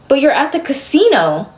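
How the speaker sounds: retold challenge, pitch rising line by line; exasperated confrontation. Daughter retelling how she confronted her mother over the casino, exasperated.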